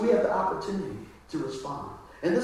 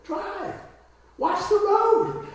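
much higher, snapping wife voice, slow on the second line; mock nagging wife; 14 semitones higher, much slower than usual. Wife telling her gadget-obsessed husband to drive, high and sharp. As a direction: bark the order like a fed-up wife. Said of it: "She goes".

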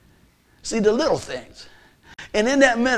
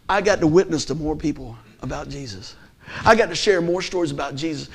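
normal talking voice

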